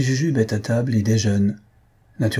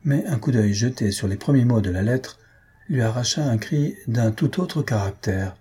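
usual speaking voice

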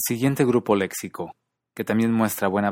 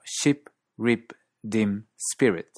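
Australian ship, rip, dim, spirit, short I almost 'ee'; Australian accent; much slower than usual. Shown as a demonstration. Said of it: "En muchos acentos australianos".

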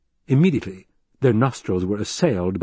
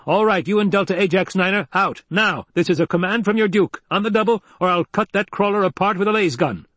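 high-pitched, forceful order, short of shouting; barked command; 10 semitones higher than usual. A narrator's reading. Duke ordering the crew out at once, barked command. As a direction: bark it out as a threat, pitched up.

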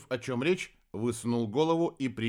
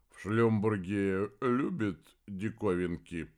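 slow, droning creature voice; droning mumble; 2 semitones lower, slower than usual. A narrator's reading. Стрек taunts Хелений about curiosities, droning mumble. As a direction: drone it out slowly. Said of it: "пробубнил тот".

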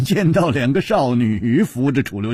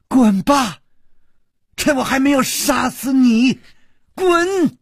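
high-pitched, explosive yell; furious shout; 13 semitones higher, 4 dB louder than usual. A narrator's reading. Shi Guanyin throwing him out, furious shout. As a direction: order him out at the top of your voice. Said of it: "大喝道".

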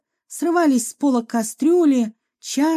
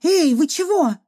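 higher-pitched indignant jolt; startled yelp; 5 semitones higher than usual. A narrator's reading. Girl to doctor who splashed tea on her, startled. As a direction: yelp it, mildly acted, as if doused. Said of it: "с воплем вскочила".

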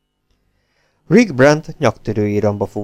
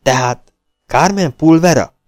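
snorting the name out, louder and higher; Rocky huffs, half-impressed, louder; huffed question; 5 semitones higher, 5 dB louder than usual. A narrator's reading. Rocky reacting to Rick's news with a huffed, louder question. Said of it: "fújtatott Rocky".

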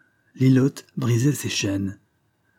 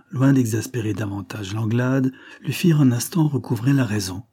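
ordinary talk, nothing marked

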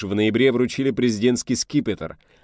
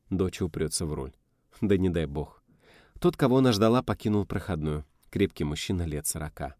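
plain reading voice

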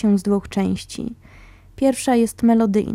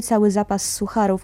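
ordinary delivery, nothing marked

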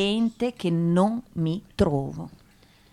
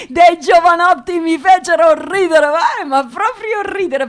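laughing; 6 semitones higher, 6 dB louder than usual. Real, not acted. Laughs recalling the boys.

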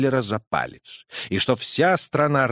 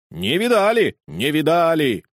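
higher, loud chanted answer; courtiers in chorus; 5 semitones higher, 4 dB louder than usual. Acted. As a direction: chant it back like a crowd of courtiers. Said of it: "отвечали хором".

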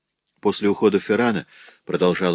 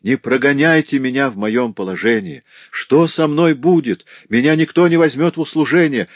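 higher, urgent entreaty; pleading; 6 semitones higher, 5 dB louder than usual. A narrator's reading. Servant girl begs her master not to dismiss her, imploring. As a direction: narrator voicing desperate begging, voice raised. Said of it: "взмолилась я".

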